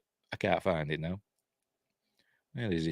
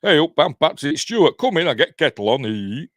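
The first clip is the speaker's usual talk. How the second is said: hammed-up Northern English accent, loud and raised; Patrick Stewart; 5 semitones higher, 8 dB louder than usual. Imagining Stewart talking at home, hammed-up Northern English accent. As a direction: exaggerate a broad Northern English greeting. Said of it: "I wonder if he talks like me".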